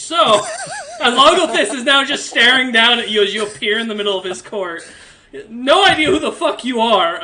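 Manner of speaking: delighted laugh while telling the twist; laughing narration. Real, not acted. GM laughs as Hawken lands in court.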